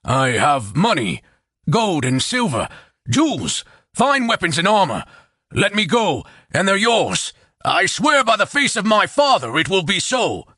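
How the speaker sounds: buy your life in a hurry; nervous bargaining. A narrator's reading. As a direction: captive chieftain pleading for his life. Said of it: "licked his cracked lips nervously".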